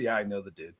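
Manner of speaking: lightly voiced inmate bragging he knows the guy; mock prison boast. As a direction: toss it off like a con claiming he knows everyone. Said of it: "Anytime someone says in prison".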